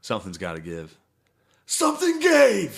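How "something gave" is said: slow, high, loud mock-dramatic yell; triumphant shout. Podcast host landing the punchline, big drawn-out shout. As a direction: draw it out like a big reveal.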